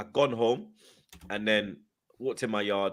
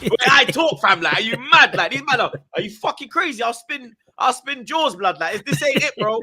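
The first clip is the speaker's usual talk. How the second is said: high, loud retold outburst; indignant refusal; 10 semitones higher, 6 dB louder than usual. Telling Joe he won't go on the channel, indignant. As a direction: fire it off in disbelief, high-pitched.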